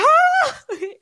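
a high, loud laughing fit; bright real laugh breaking out; high burst of laughter. Real, not acted. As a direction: crack up at the memory. Laughing at ex's reaction.

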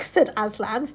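normal talking voice

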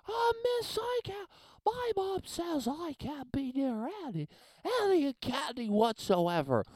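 put-on high, pinched kid voice complaining to the teacher; mock a fussy kid pleading with miss; whiny allergic kid. Comedian mocks peanut-free kid, high whiny plea. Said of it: "those kids that you could".

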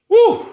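sudden high whoop of relief; loud whoop. Real, not acted. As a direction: let out a joyful woo. Cheer of relief.

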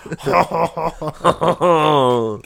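high, loud "hon hon" laugh; cartoon Frenchman's big chortle; drawn-out French guffaw; mock French laugh. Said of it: "where my entire French accent came from".

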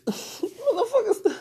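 loud, high laughing disbelief; cracking up at the absurdity; incredulous laughter. Real, not acted. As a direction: laugh through the words, drawn out.

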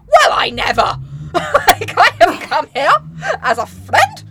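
loud, high hammed-up indignation; theatrical, overblown offence; mock affront. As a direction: wail it as the deeply wounded guest. Acts the affronted raven.

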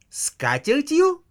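катертью echoed very high, slow, rising in outrage; wife echoes husband's insult, high outraged rise; indignant echo. Acted. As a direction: fling back "катертью" as an outraged high question. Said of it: "вскочил Ленин и подбоченился".

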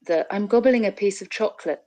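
normal talking voice

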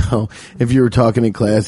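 usual speaking voice

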